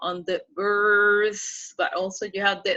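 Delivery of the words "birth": "birth" drawn out long and loud; stress on birth. Shown as a demonstration. Teacher showing students the emphasis, stressed "birth". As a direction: lean hard on "birth", stretching its vowel. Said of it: "you have to put emphasis".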